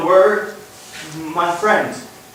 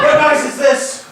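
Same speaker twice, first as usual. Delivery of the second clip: startled; 9 semitones higher than usual. Acted. Startled at the noise.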